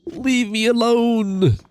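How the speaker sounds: high, drawn-out "leave me alone" wail; mock pleading wail. Streamer to chat pestering for a no hit run, mock wail. Playful protest at repeated chat request.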